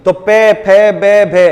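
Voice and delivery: पै फै बै भै called out loud, lips popping on each; loud sound drill. Shown as a demonstration. Teacher showing students the lip sounds, loud and clear. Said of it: "पै वर्ग की".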